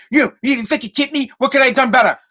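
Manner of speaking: a snide server needling a customer; condescending asshole. Retold or imitated. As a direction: sneer it like a dick. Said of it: "not in a condescending asshole way".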